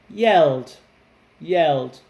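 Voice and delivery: "yelled." said loud for repeating; clear model word. Shown as a demonstration. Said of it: "Everybody repeat after me".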